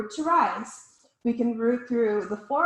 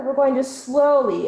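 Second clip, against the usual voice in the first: "slowly" said louder and drawn out; stress on slowly; 9 dB louder than usual. Shown as a demonstration. Teacher guiding students into a stretch, stressing "slowly". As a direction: lean hard on "slowly", stretch it. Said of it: "and really emphasize on slowly".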